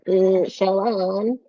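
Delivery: drawn-out, buzzing character voice; buzzy hum sliding into the word. Acted. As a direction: stretch it out with a buzz. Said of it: "it's very buzzy. It's like".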